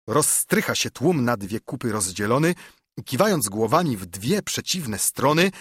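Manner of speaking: ordinary delivery, nothing marked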